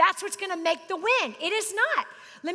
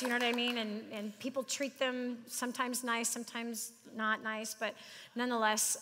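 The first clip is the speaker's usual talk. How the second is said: softer, lower, sincere; tender; 6 semitones lower, 6 dB quieter than usual. Real, not acted. Father tells congregation his kids bless him, soft and tender. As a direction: say it gently, moved.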